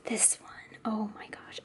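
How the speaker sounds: breathless hushed whisper; awed whisper. Real, not acted. Seeing mom's page, whispering in awe. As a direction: whisper it like you can't believe it.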